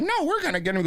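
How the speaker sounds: very high put-on refusal; insistent cashier. Cashier overrules the old man, insistent high voice. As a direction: imitate a cashier insisting, pitch way up.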